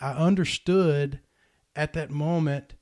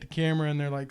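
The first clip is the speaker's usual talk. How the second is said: ordinary talk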